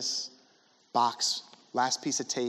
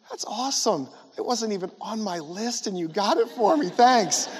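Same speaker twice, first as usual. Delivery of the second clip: forced cheerful thanks; 6 semitones higher than usual. Retold or imitated.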